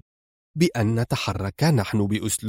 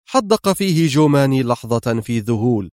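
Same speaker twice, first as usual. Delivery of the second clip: ordinary delivery, nothing marked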